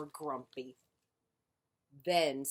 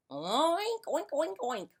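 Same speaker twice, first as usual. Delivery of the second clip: high piggy oinks, fading out; honked oinks; 11 semitones higher than usual. Acted. Pig answering grumpy Duck with high oinks. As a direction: squeal the oinks high then let them drop. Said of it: "honked Pig".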